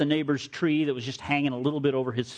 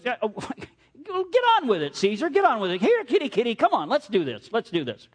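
high-pitched cocky goading; mouthy taunt; 8 semitones higher than usual. Acted. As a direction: egg them on with mock eagerness, pitch jumping up. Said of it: "Polycarp was a little mouthy".

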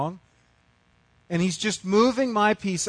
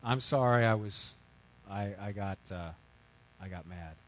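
softer and lower, trailing off in shame; sheepish apology; 9 semitones lower, 7 dB quieter than usual. Retold or imitated. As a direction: mumble it out, ashamed.

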